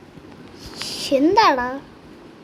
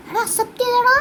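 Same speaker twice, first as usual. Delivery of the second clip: excited, higher-pitched announcement; 7 semitones higher, faster than usual. Real, not acted. Gamer tells his viewers the friend accepted, excited. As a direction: burst out with the good news.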